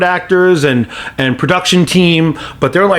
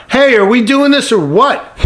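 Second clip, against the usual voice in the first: retold yell; 8 semitones higher than usual. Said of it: "yells out".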